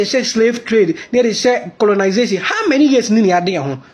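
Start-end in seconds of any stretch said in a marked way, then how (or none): none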